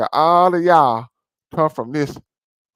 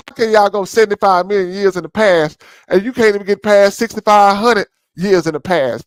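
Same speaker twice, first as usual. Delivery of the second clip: higher and louder, fed-up disbelief; exasperated shout; 7 semitones higher, 6 dB louder than usual. Real, not acted. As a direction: throw the question at them in frustration. Ranting at the audience.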